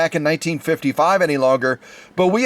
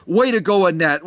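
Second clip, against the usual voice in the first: ordinary talk, nothing marked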